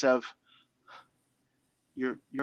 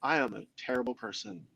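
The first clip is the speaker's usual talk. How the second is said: lower, self-condemning inner critic; saboteur voice; 5 semitones lower, 4 dB quieter than usual. Retold or imitated. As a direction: mutter it like your harshest inner critic. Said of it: "That's what the saboteur voice says".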